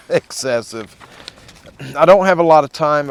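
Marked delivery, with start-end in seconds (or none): 2.0-3.1 fast, loud push over the gusts; loud over wind. Real, not acted. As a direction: raise it above the wind. Talking outdoors in strong wind.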